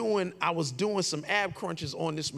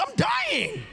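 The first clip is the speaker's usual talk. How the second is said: much higher wailing cry; mock dramatic despair. Telling his brother he is dying, hammed up high. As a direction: overplay the agony. Bleeding, begging brother for help.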